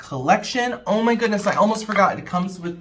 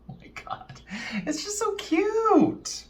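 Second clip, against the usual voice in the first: overwhelmed by cuteness. Real, not acted. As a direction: melt over how cute it is. Overwhelmed by cute palette.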